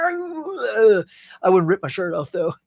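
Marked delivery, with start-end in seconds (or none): 0.1-1.0 wrestler yell. Pretend. Wrestler yell after promo.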